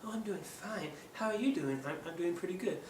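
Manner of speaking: high, soft puppet voice; a gently voiced high puppet. Shown as a demonstration. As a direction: answer meekly as the little puppet. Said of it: "So be like".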